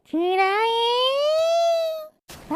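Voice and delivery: long drawn-out battle cry; screaming attack name. Acted. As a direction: stretch the attack call out slowly. Firing launcher at giant slime.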